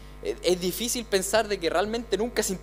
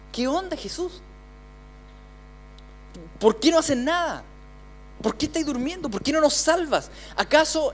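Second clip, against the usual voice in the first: higher, anguished disciples pleading; frightened disciples; 6 semitones higher than usual. Acted. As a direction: beg him to wake and save them.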